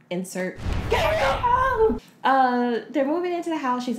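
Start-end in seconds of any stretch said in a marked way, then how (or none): 0.9-2.0 high, drawn-out mock cry; imitated name shout. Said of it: "a lot of his name being shouted".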